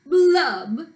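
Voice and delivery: drawn-out blubs, stepping down in pitch; loud-ish, droning pouty fish refrain; glum fish blubs. Acted. Pouty fish's refrain in story.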